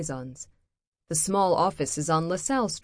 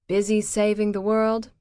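bored; 5 semitones higher, 6 dB louder than usual. Acted. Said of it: "says Dana in a bored voice".

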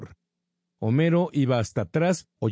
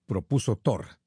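plain reading voice

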